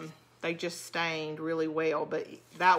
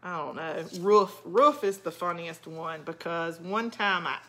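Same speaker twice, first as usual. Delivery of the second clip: ordinary talk, nothing marked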